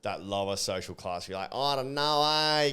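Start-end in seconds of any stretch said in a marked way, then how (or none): 1.5-2.2 broad, drawn-out bogan drawl; bogan accent. Acted. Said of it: "goes on to that bogan". 2.2-2.7 broad, drawn-out bogan drawl; bogan accent. Acted. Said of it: "cringy, bogany".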